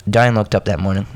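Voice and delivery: ordinary delivery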